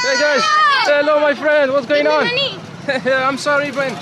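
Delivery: loud, high-pitched hail; calling out greetings. Real, not acted. Vlogger hails locals in the souk, calling out loudly. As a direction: shout a hearty hello across the street.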